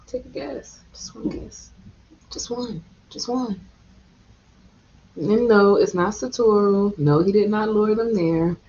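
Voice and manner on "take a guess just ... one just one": hushed teasing. Real, not acted. Teasing build-up before reveal.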